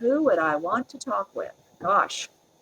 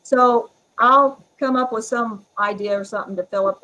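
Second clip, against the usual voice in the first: ordinary delivery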